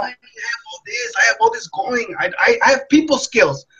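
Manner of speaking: crying, voice breaking high; tearful self-quote. Retold or imitated. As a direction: let it crack into tears as he remembers. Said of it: "I would still sometimes cry myself to sleep".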